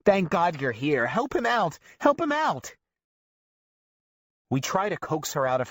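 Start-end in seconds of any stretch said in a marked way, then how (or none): 0.0-1.8 grandma screaming at her grandson's friends; relieved scream. A narrator's reading. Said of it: "Grandma sees us and screams". 2.0-2.8 even higher, shriller repeat of the plea; grandma screaming the plea a second time; louder scream. A narrator's reading. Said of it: "Grandma sees us and screams".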